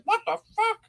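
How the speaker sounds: very high startled squeak; mock shock. Creatures stuck in the dark, very high mock shock. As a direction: shriek in disbelief.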